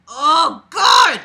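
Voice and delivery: loud, high cry of disbelief; Oh God, shouted in disbelief; exasperated shout. Real, not acted. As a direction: yell it at the ceiling. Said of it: "reacting to the story".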